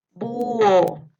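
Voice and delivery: loud, drawn-out "Búho"; dictation. Shown as a demonstration. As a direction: project "Búho" with a long u gliding into o. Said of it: "les voy a ir dictando". (dictado fuerte, alargando "Búho")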